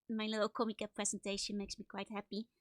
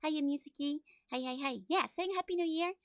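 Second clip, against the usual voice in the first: ordinary delivery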